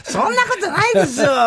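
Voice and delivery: high, laughing mock protest; laughing denial. Co-worker laughing off the accusation, high and giggly. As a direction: squeak it out through a laugh. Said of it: "なんて言うからさ".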